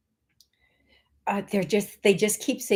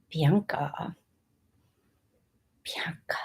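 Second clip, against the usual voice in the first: savoring whisper; 4 semitones lower, 7 dB quieter than usual. Shown as a demonstration. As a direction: whisper "Bianca" softly, lingering. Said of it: "I like that name".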